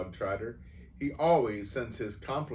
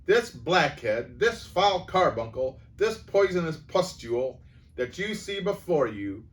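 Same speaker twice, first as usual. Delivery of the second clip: higher, louder scornful rant; furious tirade; 5 semitones higher, 8 dB louder than usual. A narrator's reading. Headmistress insulting the boy before the school, furious. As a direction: spit each insult louder.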